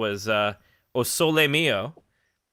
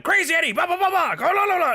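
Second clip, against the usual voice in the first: high, loud, frantic pitchman yell; screaming ad voice; 4 dB louder than usual. Retold or imitated. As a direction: scream it like a crazy TV ad. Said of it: "just, like, screaming constantly".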